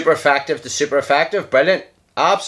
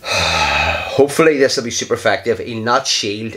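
ordinary talk

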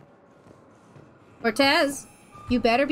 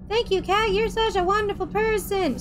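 squeaky, fawning crew voice, pitched higher; high mock gratitude; 7 semitones higher than usual. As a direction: gush thanks like an adoring crewmate. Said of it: "voicing crew thanking her".

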